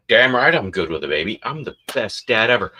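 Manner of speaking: loud, fast mock bragging; boastful dad. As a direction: crow proudly as the self-declared best dad. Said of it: "he'll start bragging to his friends".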